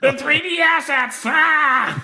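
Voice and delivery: high-pitched yelled outburst; hammed-up high holler; mock yell. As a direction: yell it high, ending in a scream.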